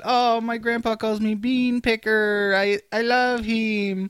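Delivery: put-on high, slow, dreamy little-kid voice; naive child. Remembering himself as a kid adoring grandpa, naive child voice. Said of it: "I just thought".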